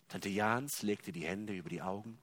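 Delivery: ordinary delivery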